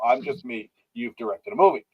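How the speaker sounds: ordinary delivery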